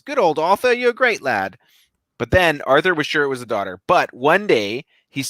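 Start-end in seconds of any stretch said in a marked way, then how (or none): 0.0-1.6 put-on high British-accented mongoose voice; high, chummy put-on character voice; Jeff. Jeff starts liking Arthur, high character voice. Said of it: "starts liking him and says".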